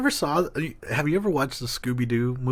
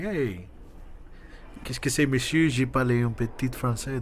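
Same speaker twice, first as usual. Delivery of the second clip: mock French; 4 dB quieter than usual. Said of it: "go to, like, a Creole person and be like".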